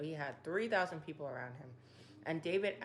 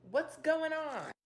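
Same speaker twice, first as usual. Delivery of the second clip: exasperated; 10 semitones higher, 4 dB louder, slower than usual. Retold or imitated. David exasperated at Saul's pursuit.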